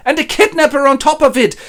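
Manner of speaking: high, loud accusation; scandalized outrage. Acted. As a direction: pile on the accusation indignantly. Miser rages at suitor.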